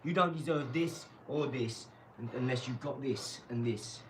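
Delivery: ordinary talk, nothing marked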